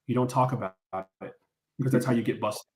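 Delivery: low-pitched, deadpan reply; serious gangster answer. Retold or imitated. Storyteller answers the drunk man's gangster question, low and serious. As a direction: drop low as if sharing a street rule.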